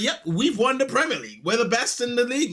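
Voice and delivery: smug boasting. Retold or imitated. Arsenal fan bragging to rival fans at work. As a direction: rub the win in everyone's face. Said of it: "I'm just talking to everyone like".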